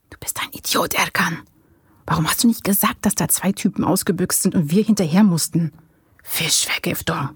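half-whispered scolding, breaking into voice; hissed reproach. A narrator's reading. Paul scolding Erkan over the fish poisoning excuse, hissed reproach. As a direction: scold him under your breath. Secret scolding between two conspirators.

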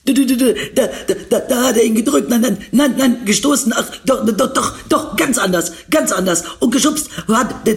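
high, hammed-up stammering imitation; sputtering, repeating every word in a high mocking voice; mock stutter. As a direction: stutter like a broken engine to mock a friend. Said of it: "stotterte wie ein kaputter Motor".

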